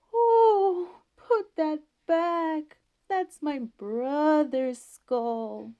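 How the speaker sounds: high, very slow wailing ghost pulling out every word; ghost's voice. A narrator's reading. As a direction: moan it like a hidden ghost, loud and drawn out. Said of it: "that old fellow hiding behind the door started in".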